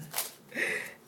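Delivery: breathy little laugh at herself; short, airy chuckle; self-conscious laugh. Real, not acted. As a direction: laugh off losing your place. Laughs at losing her place.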